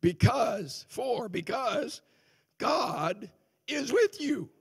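slow, high, drawn-out reveal; preacher unveiling the answer, slow and high; slow emphatic reveal. Acted. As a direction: lay out the reason slowly, climbing.

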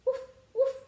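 high, bright-sounding yappy puppy woof; puppy bark. Acted. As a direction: bark like a little playful puppy. Dog barks in picture book.

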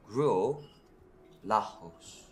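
"Gruau" rising, pause, then "Lajos" falling with a breathy throat R, "la-HOSE"; corrective pronunciation. Shown as a demonstration. Correcting a partner's French, "Gruau Lajos" pronounced emphatically in two parts. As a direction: model "Gruau ... Lajos" loudly in two separate pieces. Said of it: "that's not correct".

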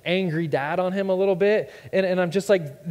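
normal talking voice

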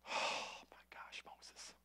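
whispered, exasperated sigh of a name. Retold or imitated. God whispering in exasperation at Moses. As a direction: breathe it out in weary disbelief. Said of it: "I just think of the Lord like this".

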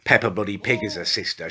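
ordinary talk

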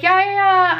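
high, loud, breezy yeah-yeah; eager agreement. Retold or imitated. Texting a friend about leaving for a workout, eagerly.

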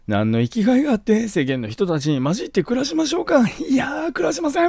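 high, tearful mock whimper; crying son. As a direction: plead through put-on tears. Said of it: "泣きながら喋ってるんですよ".

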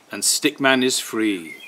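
ordinary delivery, nothing marked

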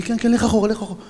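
urgent order. Retold or imitated. Comrades order him back.

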